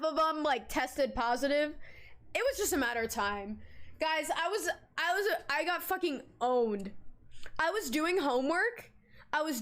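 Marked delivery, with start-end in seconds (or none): none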